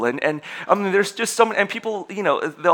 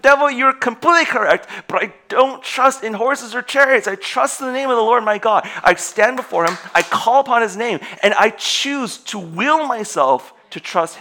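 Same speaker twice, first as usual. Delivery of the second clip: loud, much higher, declaring faith; defiant proclamation; 8 semitones higher, 6 dB louder than usual. Pretend. Believer answering the devil's accusations, loud and defiant. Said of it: "And you're like".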